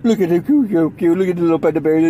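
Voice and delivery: put-on lightly babyish cooing over an animal; a hint of baby talk; mock cooing. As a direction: coo playfully as if at a baby panda. Coos over the cute panda.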